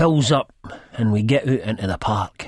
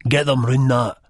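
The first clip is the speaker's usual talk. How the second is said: louder, higher menacing order; 5 semitones higher, 5 dB louder than usual. A narrator's reading. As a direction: order her with real threat. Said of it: "with real menace in his voice".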